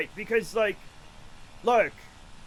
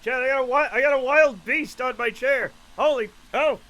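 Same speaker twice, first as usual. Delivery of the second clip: loud, raised cry of alarm; mock alarm; 4 semitones higher, 7 dB louder than usual. Real, not acted. Streamer tells chat a beast is on his chair, mock alarm. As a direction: exclaim loudly as if under attack.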